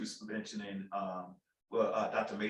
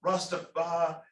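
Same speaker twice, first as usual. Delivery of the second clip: put-on Jamaican accent; 8 semitones higher, 5 dB louder than usual. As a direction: stretch it out in a Jamaican lilt. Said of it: "which is my terrible accent, Jamaican accent".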